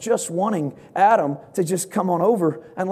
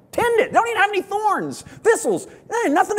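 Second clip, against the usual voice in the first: "Tend it" jumps much higher, amused; high exclaimed. Shown as a demonstration. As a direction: toss off "Tend it" high and bright. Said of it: "The Bible says".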